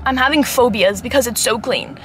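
ordinary talk, nothing marked

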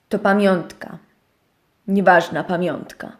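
niższy, cichszy głos szczura; niski, cichy szczur. Acted. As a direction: burknij nisko, ciszej, jak zbywający szczur. Szczur zbywa pytanie. (szczur zbywa owieczkę, nisko i ciszej)

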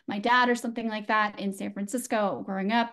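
normal talking voice

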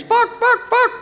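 very high parrot screech; crying parrot. A narrator's reading. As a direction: cry it out like a parrot in the treetops. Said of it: "the crow parrots cried from the trees".

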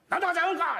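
high mock child wail; high child voice yelling at dad; imitating a crying kid. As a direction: whine like a toddler. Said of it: "言うたら俺に向かってね".

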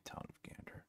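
a soft whisper to himself; hushed, almost breathless reading. Real, not acted. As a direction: whisper the name as you read it off the screen. Reading the town name to himself.

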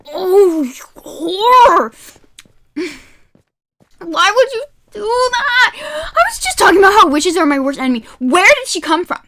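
loud, high outraged yell at the game; real yelling. Real, not acted. Streamer ambushed by a witch, yelling in outrage. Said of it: "My throat hurts so bad right now from yelling".

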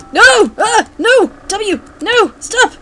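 short, high, loud yelps; rapid bursts of high-pitched protest; panicked yelps. Real, not acted. Player cries out at the game, panicked yelps.

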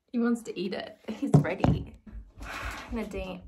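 usual speaking voice

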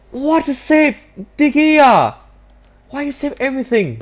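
high, loud disbelieving cry at the keeper; exasperated outcry. Real, not acted. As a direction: cry out as if robbed. Keeper saves his shot.

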